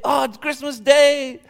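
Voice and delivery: excited. Acted. Papa waking on Christmas morning, high and thrilled. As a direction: savour the big day aloud. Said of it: "he was excited".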